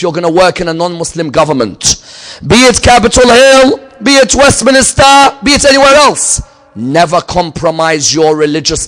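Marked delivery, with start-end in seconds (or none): 2.4-6.4 high, loud preacher's build; impassioned sermon climax; full-force preaching. Acted. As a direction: thunder it out to the congregation.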